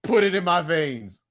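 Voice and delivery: high, loud gushing over the casting; hyped reaction. Retold or imitated. Fan hyped by casting news, gushing high and loud. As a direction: rave like a fan who just saw the news. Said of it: "I'm like".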